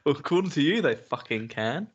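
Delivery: ordinary talk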